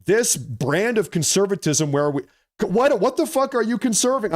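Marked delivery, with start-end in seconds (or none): 2.6-4.3 high-pitched, exasperated challenge; getting mad mid-rant; heated outburst. Real, not acted. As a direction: burst out in real anger, pitch jumping up. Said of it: "I'm sorry, I'm getting heated".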